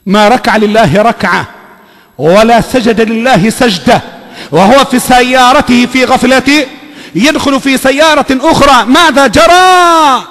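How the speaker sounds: slow, rising, loud sermon build to a shouted question; full-force preaching. Acted. Preaching a death warning.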